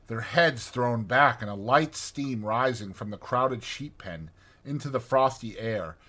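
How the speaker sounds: ordinary delivery, nothing marked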